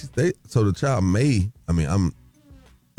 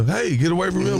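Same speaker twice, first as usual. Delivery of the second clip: higher, sharp mock shout at the child; mock yell; 11 semitones higher than usual. Host echoing co-host's yell to the kid, mock shout. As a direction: echo the harsh yell back at him. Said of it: "you see how you yell".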